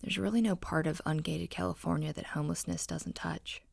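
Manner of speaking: plain reading voice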